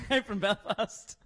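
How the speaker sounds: jokey, drawn-out Belfast greeting; Belfast accent. Shown as a demonstration. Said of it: "do my Belfast accent".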